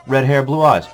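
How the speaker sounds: ordinary talk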